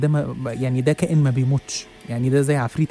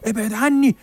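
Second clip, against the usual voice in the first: much higher, louder panicked cry of ابعد عني; terrified pleading; 9 semitones higher, 4 dB louder, slower than usual. Retold or imitated. Old man panics at the journalist, terrified high plea. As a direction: beg him to stay away in terror. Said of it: "بص لي برعب".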